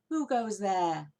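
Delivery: loud, slow booming challenge; deep voice calling. A narrator's reading. Unseen badger calls down into the tunnel, loud and slow. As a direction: boom it down from above. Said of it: "a deep voice above their heads said".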